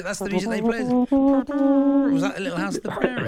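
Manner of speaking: high mock voice. Mocking the hummed tune.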